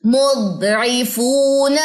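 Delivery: "मुद्रिफून" drawn out very slowly; slow paused drill. Shown as a demonstration. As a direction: say it slowly to teach. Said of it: "pause दे दे के पढ़ रही हूँ".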